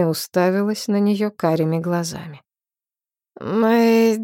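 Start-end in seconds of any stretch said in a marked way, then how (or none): 3.4-4.2 a loud ghostly wail; otherworldly howl. Acted. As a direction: howl the name like a dead spirit. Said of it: "завыло оно потусторонним голосом".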